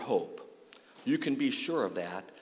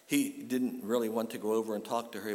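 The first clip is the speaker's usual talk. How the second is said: ordinary talk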